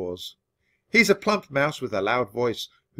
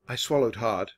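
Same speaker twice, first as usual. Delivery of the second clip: usual speaking voice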